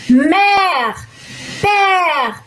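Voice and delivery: MÈRE and PÈRE with a wide-open E, drawn out; long open "eh" in Mère and père. Shown as a demonstration. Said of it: "aberto, acento grave".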